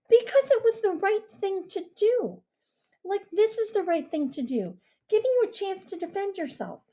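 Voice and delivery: put-on earnest girl voice. Mia earnest.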